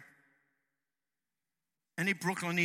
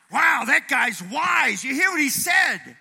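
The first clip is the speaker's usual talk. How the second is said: loud, high, drawn-out amazement; mock awe; 8 semitones higher, 9 dB louder than usual. Listener amazed by the sage's words, mock awe. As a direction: exclaim as if floored by great wisdom.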